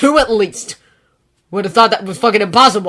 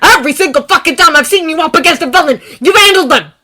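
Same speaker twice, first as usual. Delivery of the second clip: much louder, higher raging tirade; raging shout; 12 semitones higher, 16 dB louder than usual. Acted. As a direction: rage louder to the end. Bakugo's ranting accusation.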